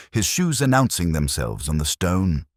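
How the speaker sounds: ordinary talk, nothing marked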